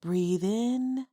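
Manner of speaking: usual speaking voice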